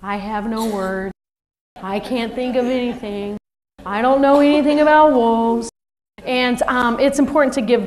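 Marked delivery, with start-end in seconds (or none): 0.0-5.7 lightly voiced slow, glum monotone; a hint of a dejected kid with nothing to say; Eeyore. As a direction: mope like a gloomy cartoon donkey. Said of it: "kids sitting there like Eeyore".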